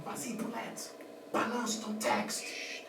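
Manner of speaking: hushed whisper to the actress; urgent set whisper. Acted. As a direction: breathe it so the take isn't spoiled. Director urging silently during take.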